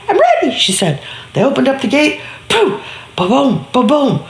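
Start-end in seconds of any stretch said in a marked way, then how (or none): 0.1-0.6 put-on eager little girl. Little girl answers the clown.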